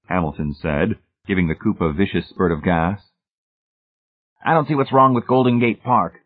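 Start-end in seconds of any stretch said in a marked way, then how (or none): 4.4-6.2 suspicious grumble, pitched higher. A narrator's reading. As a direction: object to it with suspicion. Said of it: "McFaith said suspiciously".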